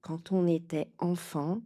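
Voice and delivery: ordinary delivery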